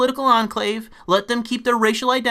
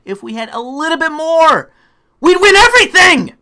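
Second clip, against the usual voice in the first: rising to a much higher, louder yell; exasperated shout; 7 semitones higher, 6 dB louder than usual. Real, not acted. Telling progressives they'd win, exasperated shout. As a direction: burst out in frustration. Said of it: "I'm getting even more angry".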